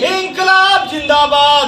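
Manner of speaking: called-out rally slogan; protest slogan call. Real, not acted. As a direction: lead the crowd's chant. Leader calls out the slogan.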